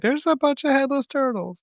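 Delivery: high-pitched imitation of a daughter; put-on high kid voice; imagined daughter's reaction. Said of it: "She'll be like".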